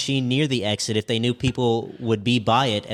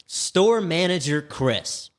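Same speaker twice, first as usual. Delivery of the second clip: dramatic slow intro; 5 semitones higher, much slower than usual. Acted. Dramatic start of the review.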